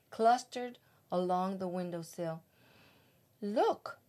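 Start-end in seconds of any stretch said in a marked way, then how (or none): 3.4-4.0 king's higher-pitched exclamation; exclaiming. A narrator's reading. King spots something on the windowsill, exclaiming. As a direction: call out a sudden discovery, pitched up. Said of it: "exclaimed the king".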